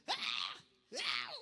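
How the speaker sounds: loud, insistent cat yowls; imitated cat crying out. Speaker imitating his cat while lunch is served, loud yowls. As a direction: yowl loudly like a cat begging. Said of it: "él empieza a clamar".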